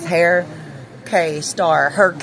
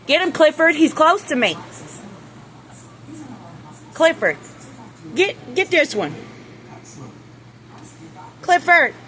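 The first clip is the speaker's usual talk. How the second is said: high, anxious calls to the rooster; nervous calling; 10 semitones higher than usual. Real, not acted. Owner calling the rooster Clifford for help, anxious and high. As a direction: call for help, a bit scared.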